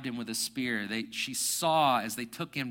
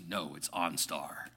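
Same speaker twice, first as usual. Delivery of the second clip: operator; 10 semitones lower, 7 dB quieter than usual. Retold or imitated. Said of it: "And the guy goes".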